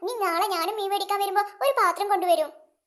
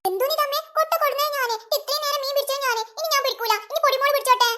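put-on higher, small child voice; 5 semitones higher than usual. Little girl complaining to her mother about the fish basket, higher child voice. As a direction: play a whiny little girl.

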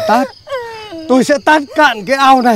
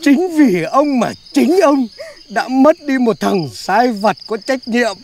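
slow solemn accusation; slower than usual. Acted. Continued mock-solemn accusation.

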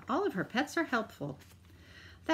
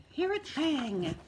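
dramatic gasp; 7 semitones higher than usual. A narrator's reading. Reading to children, dramatic.

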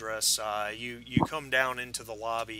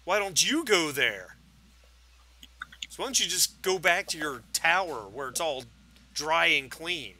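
higher, louder comeback with weight on "you"; taunting drunk; 5 semitones higher, 5 dB louder than usual. Acted. Drunk neighbor tells detectives to go away, taunting. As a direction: throw the challenge back, groping for an insult.

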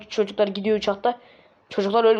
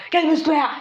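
higher-pitched protest; 6 semitones higher than usual. Acted. Son to his advancing father, high-pitched protest. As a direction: plead to be left alone. Boy backs away from angry father.

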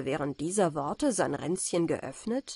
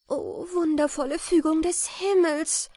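high-pitched, wondering exclamation; deeply moved; 10 semitones higher, 4 dB louder than usual. A narrator's reading. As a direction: exclaim in joyful awe. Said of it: "sprach sie gerührt".

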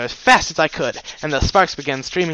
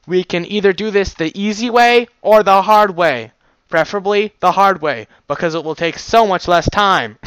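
loud, higher mock-menacing voice; menacing threat; 6 semitones higher, 9 dB louder than usual. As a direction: threaten with a smirk. Threatening the guard.

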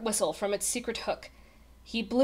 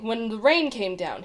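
ordinary delivery

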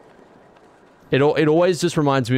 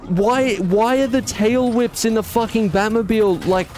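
high-pitched, incredulous griping; exasperated complaint; 10 semitones higher than usual. Real, not acted. Streamer complains about tail whips in the Batmobile, exasperated. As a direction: whine in exasperation at the game, pitch way up.

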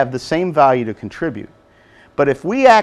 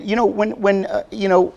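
ordinary delivery

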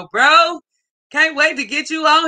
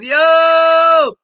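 excited call; 6 semitones higher, 6 dB louder than usual. Real, not acted. Calling out greeting to viewer.

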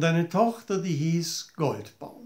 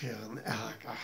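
whisper; 5 semitones lower, 13 dB quieter than usual. A narrator's reading. Narrator whispers hidden anger.

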